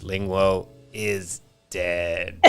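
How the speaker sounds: very slow dying robot voice. Retold or imitated. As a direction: drag it out with a last breath. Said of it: "dying breath, the robot goes".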